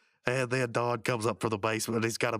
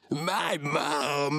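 mock triumphant holler, pitched up; Muscle Man; 4 semitones higher than usual. Prankster defying the boss's warning, gleeful mock holler. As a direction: blurt the punchline in a put-on cartoon voice. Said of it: "then Muscle Man says".

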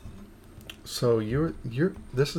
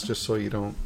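normal talking voice

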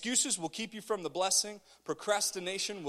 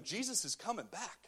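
ordinary delivery

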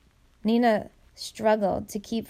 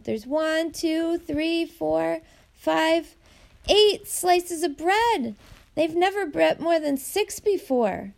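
high, eager counting aloud; excited counting; 8 semitones higher, slower than usual. A narrator's reading. Little girl excitedly counting bread slices for Nina. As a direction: count the slices breathless with glee. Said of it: "Elia squealed".

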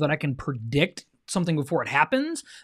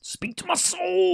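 high breathy whisper, slow; whispered swoon; slower than usual. Pretend. Husband playfully echoes wife's delight in a slow high whisper. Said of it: "playful echo".

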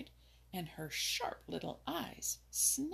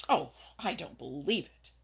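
louder, indignant denial; angry; 3 semitones lower, 8 dB louder than usual. A narrator's reading. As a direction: reject it hotly. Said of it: "declared Peter angrily".

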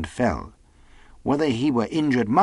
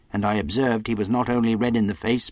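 ordinary delivery, nothing marked

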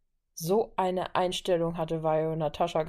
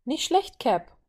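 bright-pitched call of approval, louder; 7 semitones higher, 4 dB louder, slower than usual. A narrator's reading. Violet cheers Steve's winning stroke, calling out. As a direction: cheer him on, voice jumping up. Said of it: "rief Violet".

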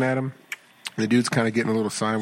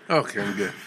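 ordinary talk

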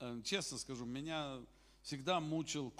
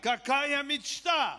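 much higher, louder, slow shout of praise; jubilant hallelujah; 8 semitones higher, 6 dB louder, much slower than usual. Real, not acted. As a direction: cry it out in triumph. Preacher exulting over David's dream.

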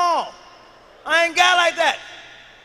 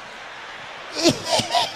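high shrieking laugh. Real, not acted. Friend cracking up at the joke, shrieking laughter.

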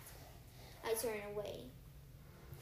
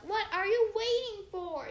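high and loud, pushing hard; demanding he strike now; impatient yell; 9 semitones higher, 8 dB louder than usual. Acted. Clarice goading Percy over the Cyclops, impatient yell. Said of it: "Clarice yelled".

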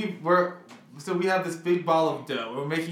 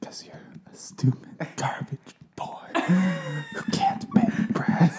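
slow teasing whisper into the ear; whisper in left ear; 3 semitones higher, slower than usual. Acted. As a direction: whisper the insult close to his ear. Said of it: "I wanna whisper to the microphone".

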